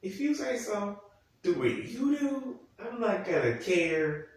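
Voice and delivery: high, drawn-out resignation; exasperated. Acted. Viewer giving up on the show's plot, exasperated. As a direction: give up in a high, stretched-out voice. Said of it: "I'm like".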